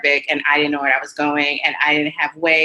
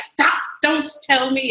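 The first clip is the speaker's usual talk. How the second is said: [name]; 6 semitones higher, slower than usual. Retold or imitated. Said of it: "I felt like I was [name] and Funny Girl".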